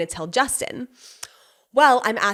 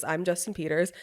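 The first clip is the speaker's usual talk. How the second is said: softer repeat of a fed line; imitating obedient echo; 3 semitones lower, 7 dB quieter than usual. Husband repeats after his wife, softer echo. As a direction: echo it back quietly, slightly lower. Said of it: "And then he goes".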